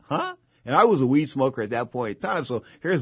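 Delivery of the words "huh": surprised huh. Retold or imitated. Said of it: "I said".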